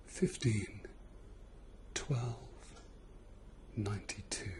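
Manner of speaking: whispered numbers in the ear. Real, not acted. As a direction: breathe the numbers softly, close in.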